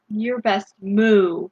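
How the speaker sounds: ordinary delivery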